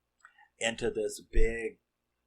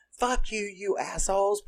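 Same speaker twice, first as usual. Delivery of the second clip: much higher, a hammed-up shriek of rage; furious nun; 11 semitones higher than usual. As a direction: scream it at them like a nun who snapped. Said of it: "starts unloading".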